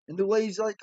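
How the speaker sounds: plain reading voice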